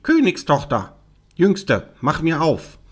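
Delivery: high-pitched calling frog voice. Acted. Frog at the door calling the princess, insistent. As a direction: call through a closed door. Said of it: "klopfte es an der Tür und rief".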